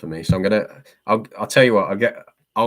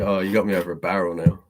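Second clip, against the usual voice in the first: ordinary talk, nothing marked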